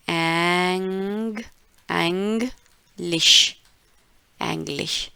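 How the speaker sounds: एंग्लिश drawn out very slowly, eng-lish with an open e; slow careful demo. Shown as a demonstration. Teacher slowly spelling out German Englisch for class. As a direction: stretch एंग्लिश out syllable by syllable. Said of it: "ये है एंग्लिश".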